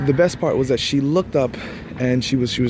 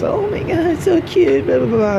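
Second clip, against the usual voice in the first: very high, squealy mock-girly gush; over-the-top high-pitched gushing mimicry; squealing girl imitation; 5 dB louder than usual.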